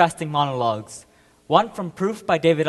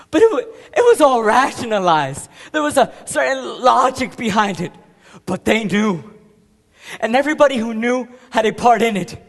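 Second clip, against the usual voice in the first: raised, insistent and high; heated protest; 5 semitones higher, 5 dB louder than usual. Acted. Soldier exposing how killings were rationalized, heated and insistent. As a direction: argue it forcefully, pitch and volume up. Bitterly argues the cover-up.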